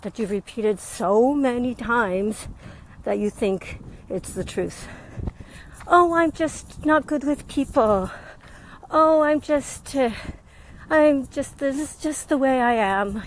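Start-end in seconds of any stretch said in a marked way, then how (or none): 5.9-13.2 higher, drawn-out imitation of self-limiting talk; lightly put-on helpless voice; mock excuse-making. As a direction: act out a resigned excuse, pitched up. Voicing people's self-limiting excuses.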